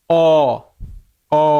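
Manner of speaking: অ as a long round vowel. Shown as a demonstration. Teacher showing the round mouth shape, a held অ. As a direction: hold অ with rounded lips. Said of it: "মুখটা কি গুল হবে".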